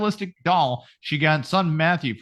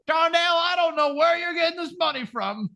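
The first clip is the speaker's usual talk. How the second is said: mocking scammer's partner. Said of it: "is just like".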